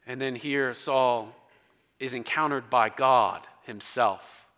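ordinary talk

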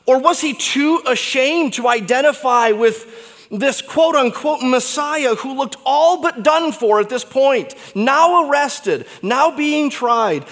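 high, loud, building questions; impassioned pulpit questions; fervent preaching. Acted. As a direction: press the congregation hard. Preaching at full force.

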